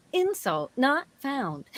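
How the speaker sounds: slow, stiff robotic Southern drawl; malfunctioning robot with attitude. Shown as a demonstration. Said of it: "a Southern robot with an attitude".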